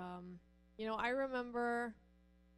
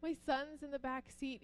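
higher, louder, flustered plea to the officer; flustered pleading; 7 semitones higher, 3 dB louder than usual. Retold or imitated. Pulled-over mother pleading with the officer, flustered and higher. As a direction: panic that her son is watching. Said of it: "And I was like".

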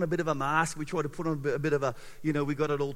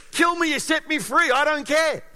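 louder, higher, defiant and unafraid; bold defiance; 7 semitones higher, 4 dB louder than usual. Retold or imitated. John the Baptist defies Herod, loud and unafraid. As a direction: throw it back at the king, fearless.

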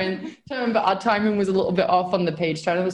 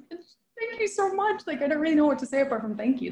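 high overwhelmed thanks; 8 semitones higher, 3 dB quieter than usual. Retold or imitated. Retelling thanking him, overwhelmed.

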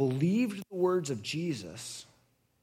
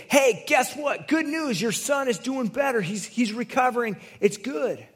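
much higher and louder, bursting with news; excited good news; 9 semitones higher, 5 dB louder than usual. Retold or imitated. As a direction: blurt it out like running messengers. Workers run up with news.

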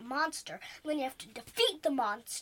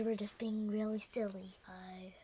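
whispering; 5 semitones lower, 7 dB quieter than usual. Real, not acted. Whispered sign-off.

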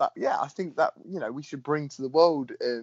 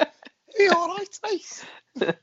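high-pitched mock Scouse greeting; Scouse accent; slower than usual. Co-host imitating English learners taught by a Scouse friend, mock Scouse accent. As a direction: put on a squeaky, playful Scouse accent. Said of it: "speaking slightly Scouse".

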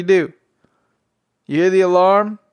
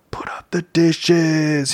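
whispered, slow and breathy secret; much slower than usual. Real, not acted. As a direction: drop to a slow whisper like a confession. Answering own question about chores.

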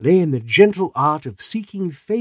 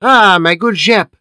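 loud, slow, high welcome; cried out; 7 semitones higher, 8 dB louder, slower than usual. A narrator's reading. Poirot greets Inspector Japp at the door, calling out loud. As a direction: call out the greeting warmly and loud. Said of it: "cried Poirot".

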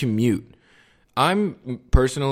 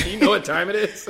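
laughing; 11 semitones higher than usual. Retold or imitated. Mock boss, laughing.

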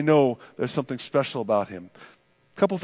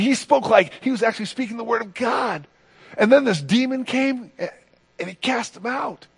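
much higher, astonished gossip voice; amazed townsfolk; 7 semitones higher than usual. Acted. Townsfolk spreading news after synagogue, amazed. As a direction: share the astonishing news in a raised pitch.

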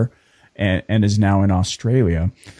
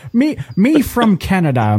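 higher, hammed-up Irish lilt; put-on Irish accent; 8 semitones higher than usual. Host joking about walking around Australia in a put-on Irish accent. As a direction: lay the Irish brogue on thick. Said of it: "really put it on".

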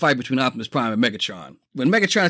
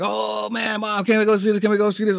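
put-on high, pleading little-kid voice; begging kid; 7 semitones higher than usual. Boy begging his mom to see the movie, high pleading voice. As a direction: beg like an eager kid.

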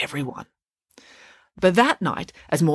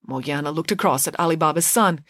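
usual speaking voice

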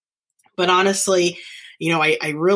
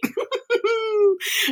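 a high breathy burst of laughter. Real, not acted. Host laughing that nobody is healthy, real laugh. Laughing at unhealthy teachers joke.

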